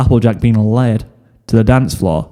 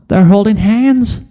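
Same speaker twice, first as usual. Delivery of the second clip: high, slow, thrilled squeak; excited squeak; 10 semitones higher than usual. Acted. Apple Bloom spying on her sister, an excited squeak. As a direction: squeak it in delight. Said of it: "Apple Bloom squeaked".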